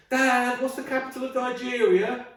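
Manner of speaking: put-on very high child's voice; squeaky innocent kid question; high-pitched little boy; kid asking dad.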